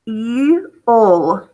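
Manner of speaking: "eel" in two parts, EE then UL; sounded out. Shown as a demonstration. Teacher showing the initial E in "eel", sounded out as ee...ul. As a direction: pull "eel" apart, long EE first, then the L. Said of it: "initial position".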